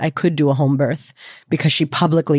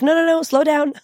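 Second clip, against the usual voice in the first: imitated cautioning midwives; 11 semitones higher than usual. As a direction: rein someone in, pitched well up. Midwives urgently telling her to slow.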